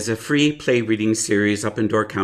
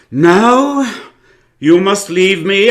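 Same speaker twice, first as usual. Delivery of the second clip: high, loud theatrical command; grand dismissal; 9 semitones higher, 7 dB louder, slower than usual. Acted. Actor ordering the visitor out before his warm-up, loud grand command. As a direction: sweep him out like a diva.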